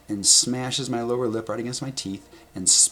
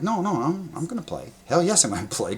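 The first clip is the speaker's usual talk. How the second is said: higher, louder keen protest to the coach; eager insistence; 9 semitones higher, 3 dB louder than usual. Retold or imitated. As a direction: pemain bersikeras ingin terus main. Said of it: "I'm like".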